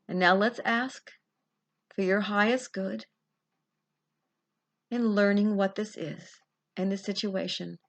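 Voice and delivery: guided visualization. Acted. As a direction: lead them gently down the corridor. Leads a past-life guided visualization.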